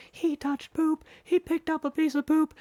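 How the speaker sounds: imitated high, squealing kid voices; tattling little kids. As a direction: squeal on him like little children. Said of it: "all three of these little kids are like".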